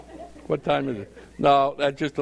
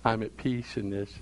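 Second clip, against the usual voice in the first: calm at peace; 4 semitones lower, 7 dB quieter than usual. Pretend. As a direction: let it rest in quiet trust. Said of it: "you're saying".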